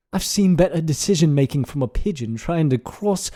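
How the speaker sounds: put-on British scolding, punchy opening; British accent. Podcaster reads a mock nightly news script, British accent. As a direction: read it as an indignant British newsreader. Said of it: "read in a British accent".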